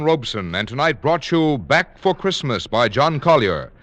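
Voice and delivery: normal talking voice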